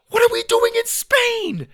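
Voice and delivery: very high, loud shriek; alarmed boy at top pitch; panicked. A narrator's reading. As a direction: squeal it in frantic disbelief. Boy panics on learning location.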